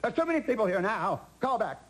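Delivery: high quavering brush-off in Hepburn's voice; Hepburn brushing him off. Retold or imitated. Burt imitating Hepburn telling him to call back. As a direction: dismiss him fast, high and imperious. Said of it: "she said".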